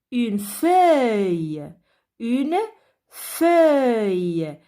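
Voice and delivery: very slow, each word stretched out and drawn long; slow, careful pronunciation. Shown as a demonstration. Teacher slowly sounding out words for children. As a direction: sound out every word slowly for young ears. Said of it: "Je vais prononcer les mots".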